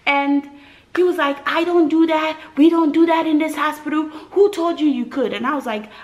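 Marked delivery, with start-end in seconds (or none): 1.6-5.3 high, loud angry doctor; yelling doctor. Retold or imitated. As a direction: shout down the patient. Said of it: "yelling at me".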